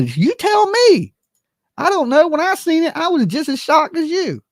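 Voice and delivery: incredulous, very high-pitched protest; high-pitched bafflement. Real, not acted. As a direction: voice shooting way up in disbelief. Throws the baffled question back at viewers.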